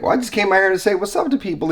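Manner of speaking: quick, high, innocent excuse; fast explanation to the guard. Retold or imitated. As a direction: brush it off fast and light. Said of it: "I was like".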